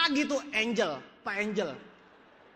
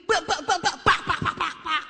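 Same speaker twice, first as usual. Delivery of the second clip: rapid-fire blabber; 6 semitones higher than usual. Acted. Speaker shows how he talked to small audiences, hammed-up staccato blabber. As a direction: imitate nonstop talking as staccato syllables. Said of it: "ngomongnya kayak begini".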